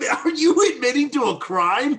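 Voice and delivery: high, loud disbelief; shocked. Real, not acted. Friends reacting in shock to a crime confession. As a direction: jump up in alarm.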